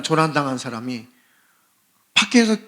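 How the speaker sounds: usual speaking voice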